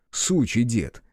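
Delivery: ordinary delivery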